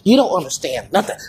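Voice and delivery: ordinary talk, nothing marked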